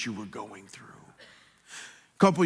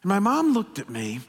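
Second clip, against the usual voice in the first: ordinary talk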